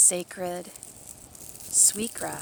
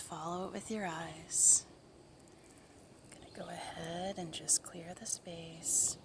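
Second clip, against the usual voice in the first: soft spa whisper; 11 dB quieter than usual. Acted. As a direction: breathe the words softly to relax them. Guided blessing in whisper.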